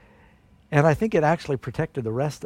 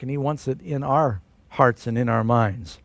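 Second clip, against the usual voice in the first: ordinary talk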